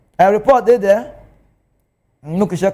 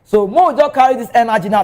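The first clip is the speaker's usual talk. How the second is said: ordinary talk, nothing marked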